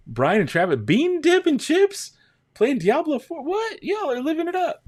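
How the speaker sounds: loud, very high squeal of amazement; excited exclaiming. Real, not acted. Streamer amazed y'all are living it up, excited squeal. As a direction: exclaim at the top of your range. Amazed at viewers' snacks and game.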